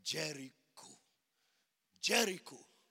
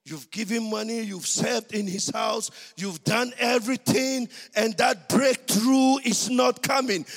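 rising from loud to shouting; building preaching; 4 semitones higher, 10 dB louder than usual. Acted.

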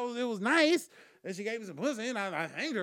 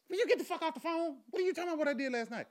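pitched up and pushed out fast, fed up with the whispering; exasperated yell; 9 semitones higher, faster than usual. Retold or imitated. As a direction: bark at your friend to quit hissing and talk. Shouting at whispering friend.

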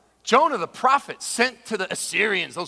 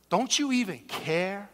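rebuke; 4 dB quieter than usual. Acted. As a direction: lean on it as a pointed reproach. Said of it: "You hear the rebuke?".

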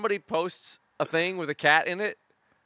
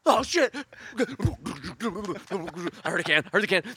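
high, loud, frantic begging cat; hammed-up falsetto cat pleading; 8 semitones higher, 3 dB louder than usual. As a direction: beg for fish like a starving cat. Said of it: "the cat goes like".

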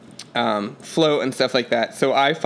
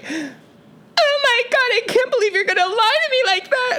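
very high-pitched imitation wail; mock hysterical crying. Duct-taped passenger accused of lying, mock hysterical wail. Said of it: "she started hysterically crying".

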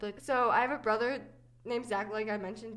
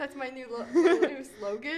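laughing, louder on slogan; 8 dB louder than usual. Real, not acted. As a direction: crack up while saying it. Laughing at the joke.

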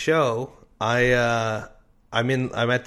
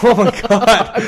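higher, louder, slow laughing disbelief; stunned disbelief; 11 semitones higher, 12 dB louder, slower than usual. Retold or imitated. Comedian realizing he partied with Chris Angel, laughing disbelief. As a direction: laugh it out in shock.

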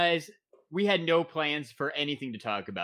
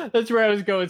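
laughing; 7 semitones higher, 6 dB louder than usual. Real, not acted. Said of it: "I couldn't say it without laughing".

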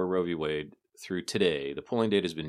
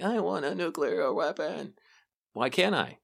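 put-on whiny childish voice; 10 semitones higher than usual. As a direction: whine it like a stubborn kid. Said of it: "I'm like".

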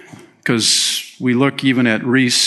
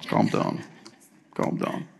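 whispered to himself; calming himself; 10 dB quieter than usual. Pretend. Pastor settling himself down, whispering to himself. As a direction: hush yourself down after the outburst. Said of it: "I'm getting too emotional".

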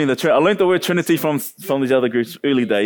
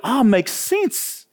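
slow realization; 9 semitones higher, much slower than usual. Retold or imitated. Sudden realization in retold story.

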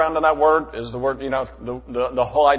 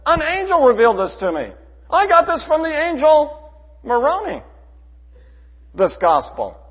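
mock claimant; 11 semitones higher, 6 dB louder than usual. Preacher voices a claimed revelation.

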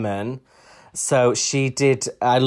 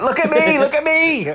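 very high, squealed plea; hammed-up excited falsetto; mock attention-seeking; 3 dB louder than usual. Acts out showing off.

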